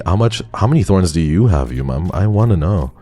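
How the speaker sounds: usual speaking voice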